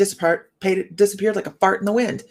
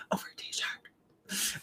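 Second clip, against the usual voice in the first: whisper; 18 dB quieter, much slower than usual. Real, not acted. Aside on husband's outburst.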